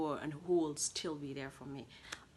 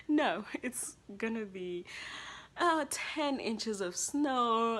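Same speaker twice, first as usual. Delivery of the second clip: slow, louder deadpan mom voice; mother's reply; 6 semitones higher, 5 dB louder, slower than usual. Retold or imitated. Mother warning her daughter of snow, slow deadpan. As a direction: break the news slowly as mom. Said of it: "My mom was like".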